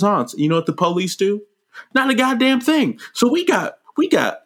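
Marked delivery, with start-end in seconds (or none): 1.9-4.4 exasperated at useless cops; indignant. Real, not acted. Ranting to Jordan about the police, high indignant outburst.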